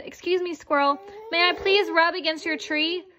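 high, timid polite request; lightly voiced gentle rhino; polite gentle ask. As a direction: ask the squirrel ever so nicely. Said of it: "tramped gingerly".

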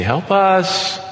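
high, desperate call for help; lightly acted plea from a sinking boat; pleading cry. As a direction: cry out to be rescued. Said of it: "cry out to him and say".

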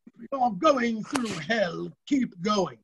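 slow, hammed-up British accent as Churchill; a deliberately bad British accent, slow. Quoting Churchill to students in a bad British accent. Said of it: "Because I do bad British accent".

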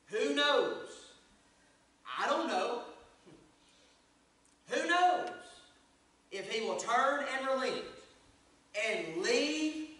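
high, ringing verse proclamation; preaching scripture. Real, not acted. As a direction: proclaim it with long pauses between phrases.

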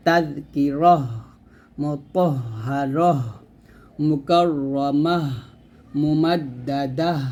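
slow recitation, ending হ voiced as a clear breathy h in মুতাহহারহ; drawn-out Arabic recitation of মুকাররামাহ, মুমাদ্দাদাহ with a final h; tajweed model reading, each word slowly apart; Quranic recitation demo. Shown as a demonstration. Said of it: "এরকমভাবে".

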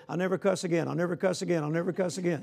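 low, even mirror chant, repeated by rote; mock rote vow. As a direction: imitate someone mechanically repeating a promise. Mimics rote vows to a mirror.

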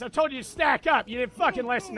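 ordinary talk

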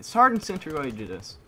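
high, loud accusing call; indignant shout. Real, not acted. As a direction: call out the killer by name. Blaming the enemy killer.

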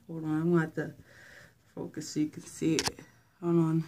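winded, short of air; catching breath between words. Real, not acted. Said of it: "Sorry, I'm out of breath".

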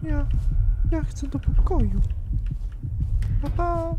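put-on childish voice. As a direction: play a small child wandering to his room. High childish voice to viewers.

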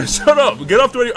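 role-played irritated shout; angry order. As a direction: cut them off, loud and impatient. Robbery role-play, cutting off the captive.

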